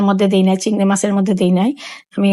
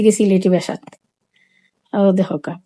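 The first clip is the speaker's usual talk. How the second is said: ordinary delivery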